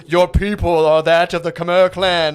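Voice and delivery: loud, slow, booming patriarch; Lion King sky voice. Retold or imitated. Dad addressing daughter from on high, mock Lion King voice. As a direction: boom it out like a father in the heavens, hammed-up. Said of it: "sounds like the sky from the Lion King".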